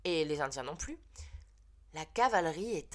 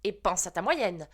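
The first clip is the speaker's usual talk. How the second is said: teacher exploding; 3 semitones higher, 8 dB louder than usual. Acted. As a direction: blow up and hand out the punishment. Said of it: "madame Balin a explosé".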